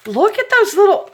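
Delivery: high, loud burst of delight; excited squeal. Real, not acted. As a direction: gasp out the cuteness. Excited by the beads.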